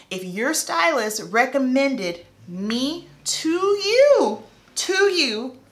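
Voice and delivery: drawn-out, rising disbelief; slow, high-pitched questioning of the logic; incredulous inner retort. Retold or imitated.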